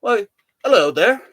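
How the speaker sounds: put-on hammy, wobbly British accent. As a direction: have a go at sounding British. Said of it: "trying to do a British accent".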